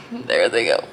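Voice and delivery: crying. Real, not acted.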